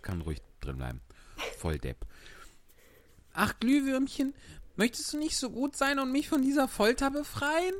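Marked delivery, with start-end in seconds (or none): 3.3-7.7 put-on high pleading puppet-boy voice; Pinocchio. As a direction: beg like a trapped wooden boy, much higher. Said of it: "du musst erst den Pinocchio rufen".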